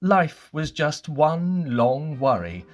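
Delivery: plain reading voice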